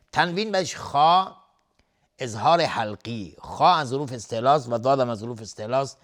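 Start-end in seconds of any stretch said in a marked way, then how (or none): none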